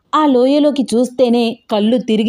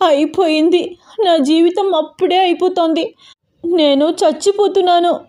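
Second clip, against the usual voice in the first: high, acted panicky wail; frightened, crying; 8 semitones higher than usual. A narrator's reading. Abhi thinks she is dying, acted tearful panic. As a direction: wail in fear, voice held high. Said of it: "భయపడుతూ ఏడుస్తోంది".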